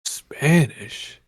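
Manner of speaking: ordinary talk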